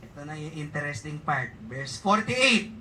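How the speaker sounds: usual speaking voice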